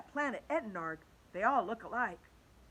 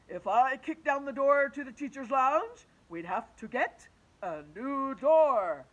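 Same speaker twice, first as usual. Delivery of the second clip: louder, slow grown-up teacher voice; teacher answering the kids, slow and louder; reasoning teacher; 4 semitones higher, 9 dB louder, slower than usual. Acted. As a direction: explain it patiently like a big teacher. Teacher Mister Cooper reasons.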